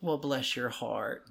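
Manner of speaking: slow, condescending bless-your-heart; sweetly veiled insult. Shown as a demonstration. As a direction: draw it out, politely calling them an idiot. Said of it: "that means you're a fucking idiot".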